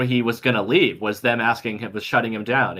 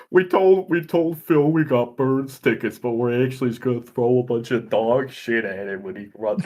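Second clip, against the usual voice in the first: put-on Baltimore accent bit; slower than usual. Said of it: "That was very good".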